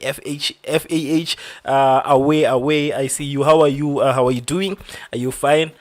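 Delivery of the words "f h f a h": F A H said as separate letter names; spelled out alphabetically. Shown as a demonstration. Streamer checking a viewer's name, F A H spelled out alphabetically. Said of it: "or it's pronounced, uh, alphabetically".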